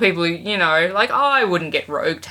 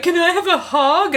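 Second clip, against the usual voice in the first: pitched up, sweet and asking; high coy mock voice; 11 semitones higher, 3 dB louder than usual. Mimicking the actress asking Hugh Jackman, high and coy. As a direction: coo it like a shy fan. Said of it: "She's like".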